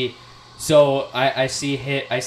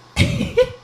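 high, sudden laugh; burst of laughter; 6 dB louder than usual. Real, not acted. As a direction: break out laughing. Friend teased about losing argument.

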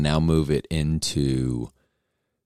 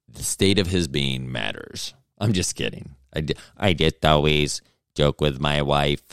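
usual speaking voice

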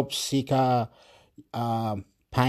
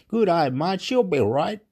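broad Aussie greeting, pitched higher; Australian accent; 5 semitones higher than usual. Shown as a demonstration. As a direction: lay on the Australian twang, a little higher. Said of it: "in Australia, we say like this".

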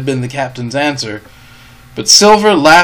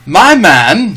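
loud, high, commanding address; Captain Smollett; 5 semitones higher, 7 dB louder than usual. A narrator's reading. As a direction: address an inferior from a distance. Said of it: "said Captain Smollett".

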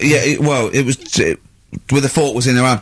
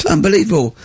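ordinary talk

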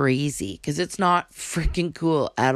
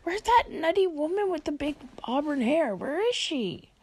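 voicing her pets; 10 semitones higher than usual. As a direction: speak as a confused little pet. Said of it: "They'd be like".

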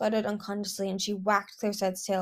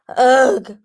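loud, higher "ugh"; exasperated groan; 4 semitones higher, 15 dB louder than usual. A narrator's reading. Exasperated inner groan before duty.

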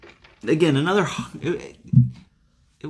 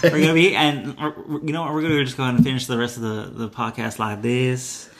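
playful mock Southern lady accent. Podcast host joking to cohost, in a mock Southern accent. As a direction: slip into a light genteel Southern belle accent. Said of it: "possessed by a genteel Southern woman".

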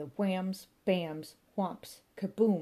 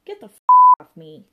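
much higher, much louder frantic yell; shout; 24 dB louder than usual. A narrator's reading. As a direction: scream it from under the heap. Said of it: "shouted Paul".

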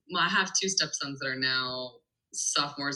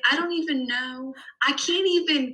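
high-pitched, flustered mimicry; mock overwhelmed; 9 semitones higher than usual. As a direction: imitate a partner at a total loss. Acts out overwhelmed husband in argument.

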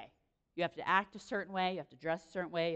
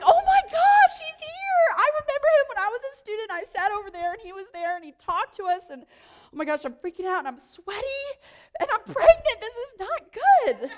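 high, loud, frantic panic; hammed-up freak-out, much higher and louder; 13 semitones higher, 8 dB louder than usual. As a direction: squeal it like you're losing it. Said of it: "seriously, I was like".

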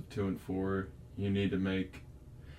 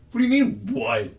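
much higher and louder, disbelieving; incredulous outburst; 10 dB louder than usual. Retold or imitated. Retelling how he confronted Mark, loud disbelief. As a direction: blurt it out in shock.

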